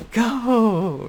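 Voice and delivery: sudden high, loud sports-parent cheer; mock cheering parent at a kid's game. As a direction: yell it like a proud parent in the stands. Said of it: "Just being a nice parent".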